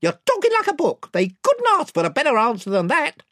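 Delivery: high, gabbling yes-man; chorus of followers. A narrator's reading. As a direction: back up the chief in a high pitch. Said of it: "said the other voices".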